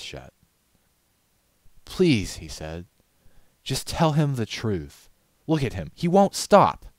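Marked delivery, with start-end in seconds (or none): 1.8-2.4 high, louder desperate please; urgent plea; pleading. A narrator's reading. Styx pleading. 3.6-6.9 higher, louder urgent begging; rising to a cry; desperate pleading. A narrator's reading. Styx begs Rarity.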